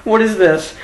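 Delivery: loud, high hammed-up panic; mock fright. Imagined garage scare, hammed-up fear. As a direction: gasp it out like something lurks. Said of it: "you're just like".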